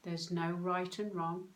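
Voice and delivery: usual speaking voice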